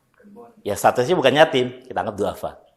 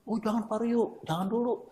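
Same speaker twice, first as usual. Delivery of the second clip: higher and softer, hesitant refusal; lightly voiced widow politely declining; the widow gently turning down the offer, higher and quieter; shy widow; 7 semitones higher, 15 dB quieter than usual. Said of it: "Ibu kan janda nih".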